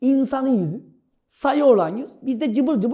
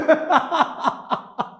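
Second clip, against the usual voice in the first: high giggling laugh; 4 semitones higher than usual. Real, not acted. Cracks up at own act.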